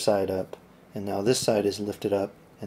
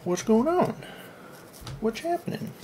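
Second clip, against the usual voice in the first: much higher, baffled questioning; puzzled; 12 semitones higher than usual. Real, not acted. Tinkerer baffled by his gadget, puzzled and high. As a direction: sound genuinely puzzled by the gadget.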